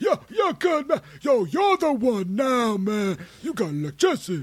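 imitating a friend's Pete the electrician voice. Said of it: "Pete the electrician".

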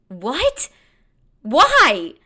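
high, loud disbelief on "What? Why?"; incredulous. Retold or imitated. To her date about the brie gift, loud disbelief.

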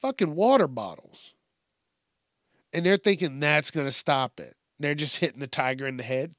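ordinary talk, nothing marked

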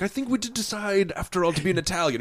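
lightly imitating a snooty courtier; mock pompous courtier. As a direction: speak like a fussy old court official. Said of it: "And he's like".

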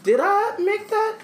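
high wondering voice that sinks to near quiet; mock paranoid shopper. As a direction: play a suspicious shopper doubting herself. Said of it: "I'm like".

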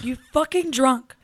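slow, loud, disgusted yell; loud and slow, scolding her drunk brother; angry yell. Retold or imitated. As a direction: spit each word at him.

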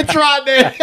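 high, laughing through words. Real, not acted. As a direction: laugh while you talk. Both react to rotten egg.

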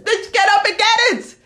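sudden high-pitched yelled order; exasperated yell. Acted. Speaker scolds the whiner to act, exasperated yell. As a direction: yell it as a kick into action.

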